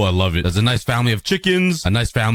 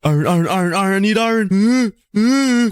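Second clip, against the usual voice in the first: much higher, frantic plea; panicked; 8 semitones higher than usual. Real, not acted. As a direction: blurt it out in a rush of panic. Panicking while mobs attack.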